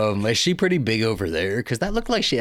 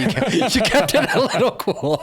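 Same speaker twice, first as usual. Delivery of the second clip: laughing through the words, pitched up; 12 semitones higher, 3 dB louder than usual. Real, not acted. Host teasing guest about screaming, laughing. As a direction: tease him while cracking up.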